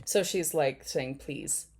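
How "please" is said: soft, understated imitation of pleading; begging. Host voicing the woman in the clip saying please, quietly begging. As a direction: plead quietly on someone else's behalf. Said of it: "she's begging".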